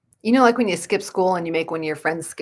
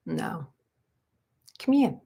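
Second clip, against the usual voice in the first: normal talking voice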